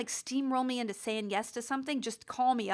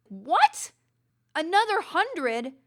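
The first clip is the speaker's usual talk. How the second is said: louder, higher, the second line stretched out slowly in outrage; shocked disbelief; 4 semitones higher, 5 dB louder, much slower than usual. Retold or imitated. Retelling the cobbler's phone call, stunned and louder. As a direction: hear the price and balk at it.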